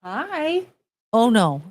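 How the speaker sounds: ordinary talk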